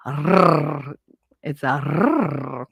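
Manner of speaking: "R" with a drawn-out rolling r; full R. Shown as a demonstration. Jersey girl proving she can say it, over-pronounced "R". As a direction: hold the r in "R" long and hard. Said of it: "That's an R".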